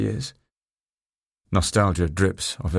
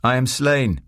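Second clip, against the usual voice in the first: raised voice feeding a Shakespeare line; higher pitch, falling on "slain"; prompting a line; 5 semitones higher, 3 dB louder, slower than usual. A narrator's reading. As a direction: prompt an actor with a brief stage cry. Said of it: "I decided to help her out".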